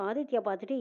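ordinary delivery

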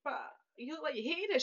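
high-pitched baffled protest; retold disbelief. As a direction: recreate your own puzzled objection. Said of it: "I was like".

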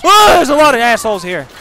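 high, loud, fast alarm; yelp, then rush the words; startled yell. Real, not acted. Streamer running into many enemies, startled high yell.

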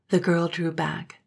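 usual speaking voice

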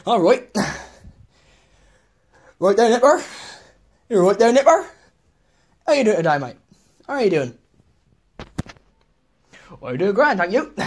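loud, hearty West Country farmer; hammed-up West Country accent. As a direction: greet him like a cheery West Country local. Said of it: "The West Country".

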